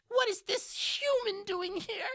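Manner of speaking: high pitched imp voice; suspicious imp. Acted. Imp questioning the disguised party in a high squeak. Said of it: "it looks over at Sereneth and goes".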